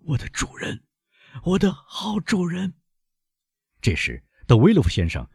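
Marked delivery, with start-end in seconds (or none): 0.0-2.7 high, soft, failing plea; anguished cry. A narrator's reading. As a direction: cry weakly to your master as you collapse. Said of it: "一面喊道".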